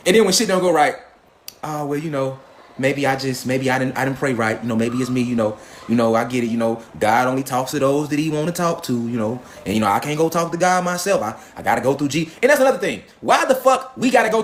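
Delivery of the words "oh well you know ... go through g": low, soft, self-doubting churchgoer; mock meek believer. Comic mocking a meek believer in a low, soft voice. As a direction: play a timid believer blaming himself.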